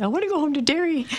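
high mock Irish wail; playful imitation of a drunken Irishwoman; Irish accent. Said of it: "we've always said".

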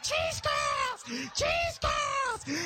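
high-pitched, hopped-up shouting; mock-frenzied squeal over a snack; excited kid. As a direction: imitate a caffeine-crazed little brother. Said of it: "he's so excited".

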